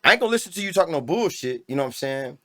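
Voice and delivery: normal talking voice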